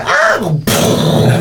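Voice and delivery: high mock crowd scream; imitated panicked scream. Game master voicing people hit by an explosion, mock scream. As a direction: cry out high like startled bystanders. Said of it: "you hear people go".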